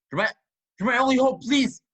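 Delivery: desperate pleading. Acted. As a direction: plead with the leaving actor. Director begging actor to stay.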